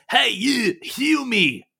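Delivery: high, loud gruff dwarf yelling at a stranger; angry dwarf shout. Acted. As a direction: bark it like an outraged mountain dwarf. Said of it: "one of them says".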